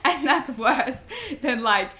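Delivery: breaking into high laughter mid-sentence; laughing. Real, not acted. Laughing at her own threat to punch someone, high laughter. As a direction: laugh it out, voice jumping up.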